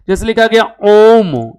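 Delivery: stretching ओम long and slow; drawn-out ओम. Shown as a demonstration. Teacher stretching ओम slowly for the class. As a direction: hold the word ओम for three beats. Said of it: "जैसे ये संस्कृत वाला".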